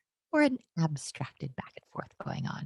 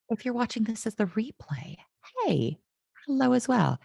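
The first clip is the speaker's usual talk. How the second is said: ordinary talk, nothing marked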